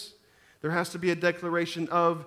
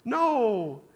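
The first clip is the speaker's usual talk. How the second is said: higher, slow mock whine of a small son; crying little boy; 8 semitones higher, much slower than usual. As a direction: plead like a toddler begging dad to stay. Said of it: "he's crying for me".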